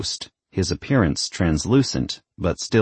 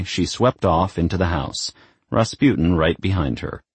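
ordinary delivery

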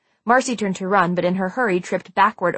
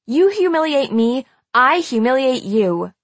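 higher, emphatic tit-for-tat threat; vindictive; 5 semitones higher, 4 dB louder than usual. A narrator's reading. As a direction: hammer home the revenge. Marcy declares revenge.

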